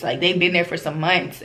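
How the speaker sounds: ordinary talk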